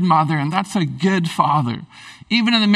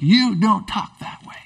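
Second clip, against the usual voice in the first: slow, stern fatherly warning; measured, ungentle telling-off; serious rebuke; 2 semitones higher than usual. Acted. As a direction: lay down the law slowly. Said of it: "It wasn't gentle. It was serious".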